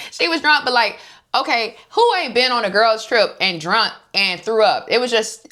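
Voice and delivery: high, loud, incredulous protest; who hasn't done this, come on; rising outrage on her behalf; exasperated disbelief. Real, not acted. Indignant rant about drunk trip.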